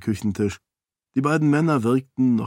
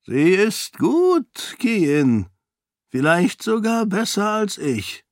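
much higher, slow, voiced old nobleman; grudging admiration; impressed old duke; 10 semitones higher, slower than usual. A narrator's reading. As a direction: duke overheard praising Sarene in the kitchen. Duke praising Sarene.

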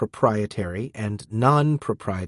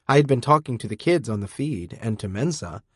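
plain reading voice

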